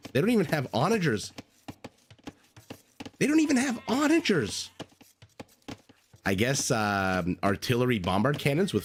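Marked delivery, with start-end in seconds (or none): 0.1-1.3 higher-pitched, quick disbelief; incredulous complaint. Real, not acted. Griping about terrible siege line, first take. 3.2-4.7 very high, exasperated squeal of disbelief; high-pitched disbelief. Real, not acted. Repeats the gripe, far higher.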